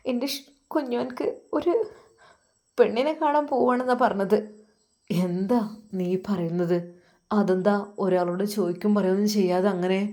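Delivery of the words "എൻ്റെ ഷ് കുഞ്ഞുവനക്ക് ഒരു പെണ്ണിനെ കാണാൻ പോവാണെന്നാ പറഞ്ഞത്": hesitant wife. Acted. Wife voiced.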